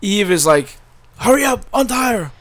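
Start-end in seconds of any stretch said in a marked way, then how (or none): 1.2-2.3 high, rushed mock urging; squeaky hurry-up order; urgent Eve. Podcaster voicing Eve urging the girl, high and rushed.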